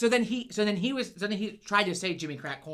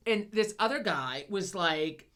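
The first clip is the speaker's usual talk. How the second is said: ordinary delivery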